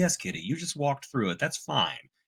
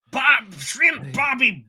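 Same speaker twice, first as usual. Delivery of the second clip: hammed-up, higher Australian caricature; bad Australian accent; 4 semitones higher, 4 dB louder than usual. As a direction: do a broad mock-Australian voice. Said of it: "they made Wolverine Australian again".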